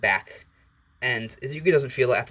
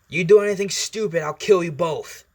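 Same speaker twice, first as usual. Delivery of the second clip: loud, raised-pitch warning, slowing at the end; threatening; 7 semitones higher, 5 dB louder than usual. Acted. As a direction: lay down a mock-fierce threat. Acted Hisashi threatens the two boys.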